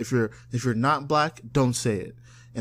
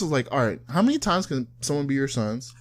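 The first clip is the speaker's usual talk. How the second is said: normal talking voice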